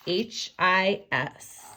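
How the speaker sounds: slowly spelled "H I S." letter by letter; name each letter slowly for the class; spelling out. Shown as a demonstration.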